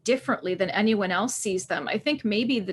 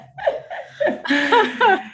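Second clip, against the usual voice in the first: high embarrassed giggle; bright self-mocking laugh; 13 semitones higher, 5 dB louder than usual. Real, not acted. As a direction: giggle at yourself, a little sheepish. Laughs at own quirk.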